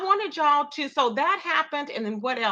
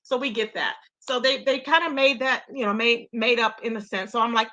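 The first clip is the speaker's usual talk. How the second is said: ordinary delivery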